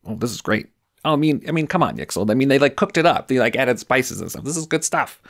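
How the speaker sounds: high, eager, gushing elf voice. Acted. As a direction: snap up the cheese with glee.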